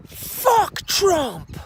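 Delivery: high, loud shout from a balcony; loud yell. Retold or imitated. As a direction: holler it down at him. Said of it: "one of them yells really loud".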